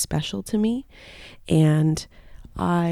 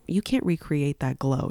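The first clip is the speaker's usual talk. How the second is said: ordinary delivery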